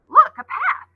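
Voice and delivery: high, loud burst of discovery; excited cry. Acted. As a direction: point it out eagerly, pitch jumping high. Coco spots the path.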